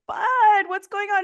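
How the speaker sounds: mother asks her crying son what's wrong; worried mom. Retold or imitated. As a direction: check on a sobbing boy. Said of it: "And I was like".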